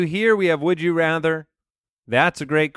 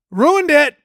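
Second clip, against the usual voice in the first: high-pitched, louder mock shout; 10 semitones higher, 6 dB louder than usual. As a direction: shout it out, imitating a co-host. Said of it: "what has Jason shouting".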